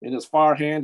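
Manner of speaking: usual speaking voice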